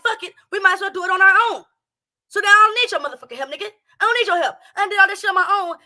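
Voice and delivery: loud, fast fed-up tirade; fed-up rant. Acted. Streamer voicing fed-up women telling men they need no help. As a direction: rattle off the angry rant.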